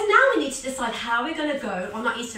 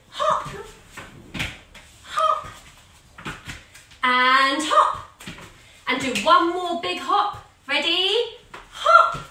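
much higher, bouncy calls for each hop; excited call; 4 semitones higher, 5 dB louder, slower than usual. Shown as a demonstration. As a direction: cheer the kids on each hop. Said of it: "he does a bunny hop".

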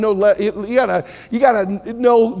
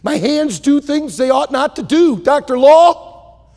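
desperate plea; 7 semitones higher, 7 dB louder than usual. Acted. Lester begs doctor for surgery.

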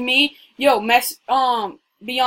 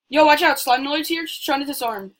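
ordinary delivery

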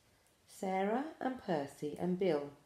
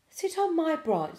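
put-on high loud baby owl call; little owl calling the others over, high and loud; 11 semitones higher, 8 dB louder than usual. A narrator's reading. Sarah calling her siblings onto her branch, high.

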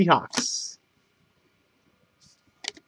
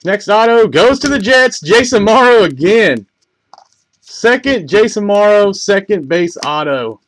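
raised, louder voice for a big hit; excited announcement; 4 semitones higher, 5 dB louder than usual. Real, not acted. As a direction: call out the autograph with real excitement. Announcing another autograph card hit.

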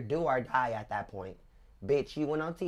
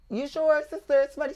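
high, louder doubting question; high disbelieving question to her sister; doubtful question; 11 semitones higher, 8 dB louder than usual. Retold or imitated. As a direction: ask it like you can't believe her. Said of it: "So I said".

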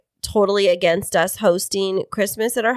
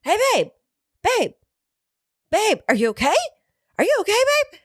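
high, hammed-up imitation of a fussing husband; mock worried husband; 13 semitones higher than usual. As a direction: squeak it out like an overanxious husband. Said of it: "And then I hear".

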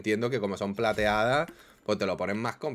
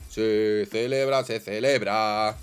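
slow, stretched victory chant; drawn-out cheer; 4 dB louder, much slower than usual. Real, not acted. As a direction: drag out each word in celebration. Sigue celebrando, canturrea lento. (celebración lenta y alargada)

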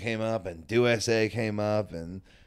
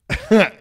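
loud laugh; 10 semitones higher, 11 dB louder than usual. Real, not acted. Laughing at friend's story.

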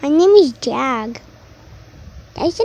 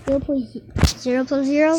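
silly fast voice; much faster than usual. Pretend. Teacher teasing the student with a fast quiz question. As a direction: rattle "Zero point zero zero plus zero?" off very fast. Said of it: "Stop talking like that".

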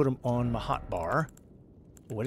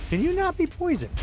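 squeaky, high, incredulous; high exasperated squeak; 5 dB louder than usual. Real, not acted. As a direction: squeal it in disbelief.

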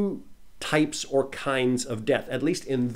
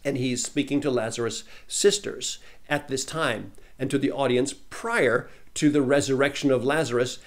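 ordinary talk, nothing marked

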